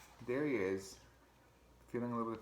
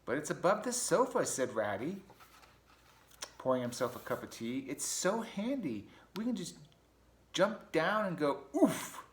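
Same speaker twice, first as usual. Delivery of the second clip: Ratty voice; 6 semitones higher, 4 dB louder than usual. Acted. Ratty to grumpy Mouse about jumping down, high and playful. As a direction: sell the hole as a treat.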